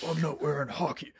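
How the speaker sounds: hammed-up growled Batman impression; gravelly Batman voice. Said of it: "low and gravelly".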